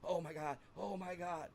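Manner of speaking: high-pitched retold panic; tripping out. Teller imitates himself seeing lights run round his body, high and panicked. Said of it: "Like, I am tripping out".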